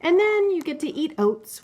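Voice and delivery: playful book characters. Acted. Mother reading a picture book to her child, lively character voices. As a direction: read the animal friends' squabble with bounce.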